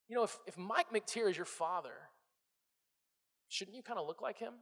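soft, hesitant planting of doubt; subtle insinuation. Acted. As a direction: drop the voice and gently sow doubt. Voicing a subtle, doubt-planting questioner.

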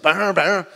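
loud mock barking grab at bubbles; dog noise imitation. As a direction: bark it out like a hyper terrier. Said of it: "And he's just like".

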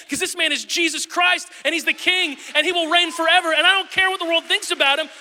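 loud, high fervent preaching climax; full-force preaching. Acted. Pastor rallying the church to stand by Jesus, fervent and loud. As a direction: build to a shouted declaration of faith.

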